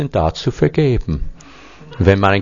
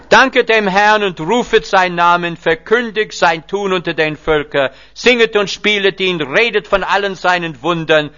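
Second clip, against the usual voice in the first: raised, solemn psalm recitation; prayer reading; 5 semitones higher than usual. Acted. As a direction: read the psalm as a prayer to the congregation. Gebet aus Psalm. (feierliches Psalmgebet)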